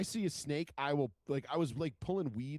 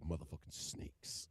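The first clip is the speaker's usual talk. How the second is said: low, slow near-whisper; hushed video impression; 9 semitones lower, 10 dB quieter than usual. Fan imitates guy in the snakes video, hushed and slow. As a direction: murmur it slowly, barely voiced.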